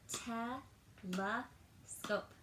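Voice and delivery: Te-le-scope, each part drawn out very slowly; slow syllable clapping. Shown as a demonstration. Teacher claps syllables with kids, very slow. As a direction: stretch telescope out, one clap per part. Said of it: "clap all of the parts with me really slowly".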